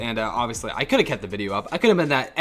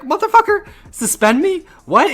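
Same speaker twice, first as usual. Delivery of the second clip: much higher, louder disbelieving yell; shocked outburst; 7 dB louder than usual. Retold or imitated. The student hears the dean will suspend him, a shocked high yell. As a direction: blurt it out in outraged disbelief.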